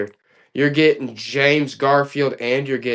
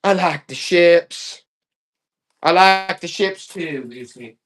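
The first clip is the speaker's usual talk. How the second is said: voicing Lucy; slower than usual. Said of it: "She's like".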